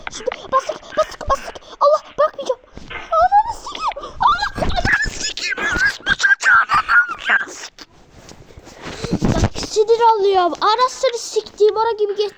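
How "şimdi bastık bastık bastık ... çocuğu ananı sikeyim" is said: very high, shrill crying yell building; anguished screaming. Real, not acted. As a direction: wail and shriek in rage as you fall. Failing jump, crying out.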